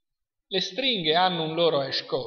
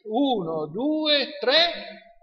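drawn-up cue, higher on each number; rising count-in; 4 semitones higher, much slower than usual. Real, not acted. Teacher cues the class to answer together, rising count-in. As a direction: count in a group, pitch climbing.

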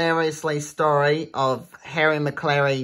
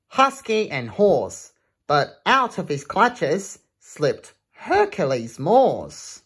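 lively rhythmic read-aloud; reading a rhyming book to children; storybook reading; 2 semitones higher than usual. Acted. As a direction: bounce through the rhyme for kids. Reads picture book aloud.

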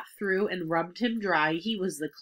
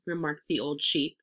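usual speaking voice

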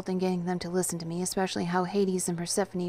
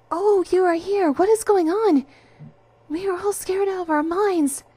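high, loud, frantic outcry; high-pitched panicked greeting; alarmed exclamation; 12 semitones higher, 9 dB louder than usual. A narrator's reading. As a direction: cry out in fright, pitched way up. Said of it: "Penny exclaimed".

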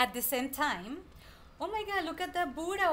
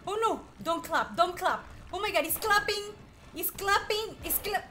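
high, urgent panicked cries; frantic gamer panic; 5 semitones higher than usual. Real, not acted. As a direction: plead in rising alarm as the attack comes. Boss starts clapping attack.

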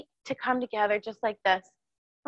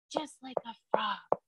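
playful whisper; 8 dB quieter than usual. Pretend. Playful whisper in kids' song.

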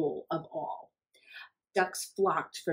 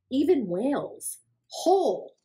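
rising higher and louder to a big finish; building excitement; 7 semitones higher, 5 dB louder than usual. A narrator's reading. Reading to kids, building to a big finish. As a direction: swell up on whales, whole.

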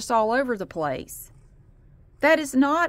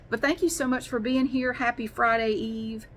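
ordinary talk

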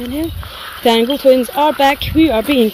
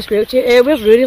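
usual speaking voice

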